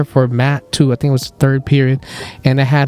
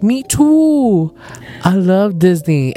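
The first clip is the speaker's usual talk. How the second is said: high eager gush, then slow; excited; 4 semitones higher, much slower than usual. Retold or imitated. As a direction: gush like a Disney fan. Self excited reply.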